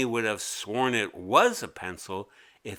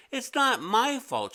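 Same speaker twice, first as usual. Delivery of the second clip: put-on much higher, protesting kid; innocent girl voice; 12 semitones higher than usual. A narrator's reading. Mimi insisting to the teacher it's not her fault, high. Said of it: "she said".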